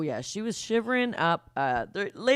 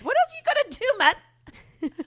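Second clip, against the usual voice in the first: exasperated; 5 dB louder than usual. Acted. Mock exasperation at judges.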